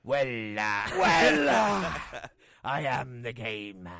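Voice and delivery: hammed-up wrestler catchphrase, drawn out; loud mock-growled "well", then a slow boast; Triple H. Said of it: "That doesn't sound like Triple H".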